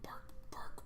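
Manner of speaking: bark, bark whispered, barely voiced. Real, not acted. Player whispering bark bark in answer to the GM. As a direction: whisper the barks to the table. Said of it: "how do you respond?".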